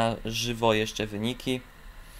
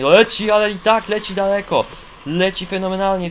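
louder and higher as the jump flies; rising excitement; 7 semitones higher, 6 dB louder than usual. Real, not acted. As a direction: build up to a thrilled call. Commentating a long jump.

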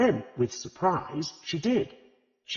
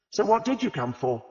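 demanding harshly; 8 semitones higher, 3 dB louder than usual. A narrator's reading. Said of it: "she demanded harshly".

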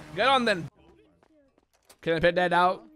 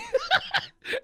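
high-pitched cackling laugh; real laughter; 3 dB quieter than usual. Real, not acted. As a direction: burst out laughing at your own mistake. Laughs at own foolishness.

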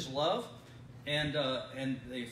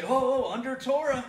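higher, louder mock gasp of alarm; mocking people; 8 semitones higher, 9 dB louder than usual. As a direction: parody the scandalised reader. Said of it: "verses that people say".

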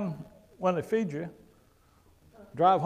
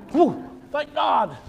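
hammed-up sigh of relief, pitched higher and louder; mock relief; 8 semitones higher, 9 dB louder, much slower than usual. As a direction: play a relieved listener, overdone. Voicing the congregation's relief.

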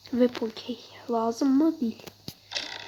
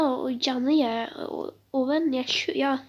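ordinary talk

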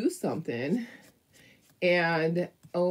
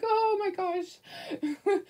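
slow, high and tearful, relieved; tearful relief; 13 semitones higher, much slower than usual. Retold or imitated. Hearing the dog is fine, in slow tearful relief. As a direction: let the relief nearly make you cry. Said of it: "And I was like".

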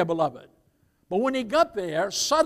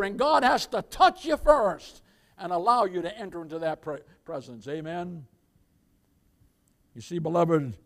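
ordinary delivery

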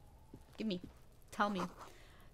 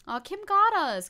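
higher, louder amused outburst; excited; 10 semitones higher, 7 dB louder than usual. Real, not acted. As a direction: blurt it out delighted. Excited reaction to Kim.

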